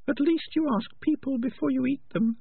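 very high, thin rat voice; tiny high-pitched rat, pointed; prim female rat. Acted. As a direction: squeak it out as a small rat.

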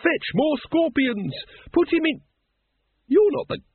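hysterical, very high-pitched, breaking off; screamed orders. A narrator's reading. Once screams orders to guards who are not his, screaming. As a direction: scream commands, then cut short in alarm. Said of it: "screamed Once".

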